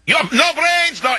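very high-pitched ranting of an enraged Russian; mock Russian screaming. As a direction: imitate a livid Russian shrieking in broken English, without extra volume. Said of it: "and he's screaming and yelling".

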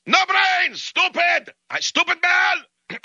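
mock Russian shriek, very high and drawn out at the end; screaming Russian. Enraged Russian answers the offer to pay, screaming. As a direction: hurl insults in a frantic high Russian imitation. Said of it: "he's screaming and yelling".

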